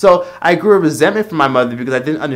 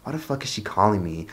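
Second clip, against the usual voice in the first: annoyed aside; 5 semitones lower, 11 dB quieter than usual. Retold or imitated. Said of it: "I would just be like".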